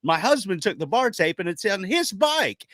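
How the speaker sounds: lightly voiced, delighted local woman; proud stranger. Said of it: "she goes".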